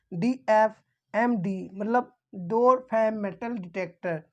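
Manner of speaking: ordinary delivery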